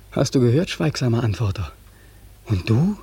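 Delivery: ordinary delivery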